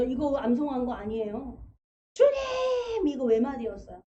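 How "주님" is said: high, loud single-word cry to the Lord; desperate cry. Retold or imitated. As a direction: call out "주님" as a desperate plea. Sermon retells a desperate one-word cry. (간절하게 외치는 한마디)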